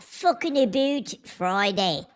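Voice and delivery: slow mock-Canadian "aboot"; exaggerated Canadian vowels, drawn out; Canadian accent. Shown as a demonstration. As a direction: say it like a hammed-up Canadian. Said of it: "Like, like Canadian?".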